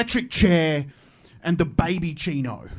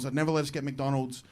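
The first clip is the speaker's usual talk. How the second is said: soft, lower echo of the line before; softer repeat; 5 semitones lower, 7 dB quieter than usual. Retold or imitated. Repeating the parents' rule.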